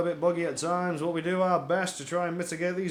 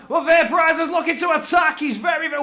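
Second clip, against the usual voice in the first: excited; 8 semitones higher, 7 dB louder, faster than usual. Real, not acted. Attack begins.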